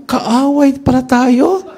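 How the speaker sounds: high, loud and slow; hammed-up indignant enemy; mock indignation. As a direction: drag it out, mock offended. Mocking the enemy's reaction.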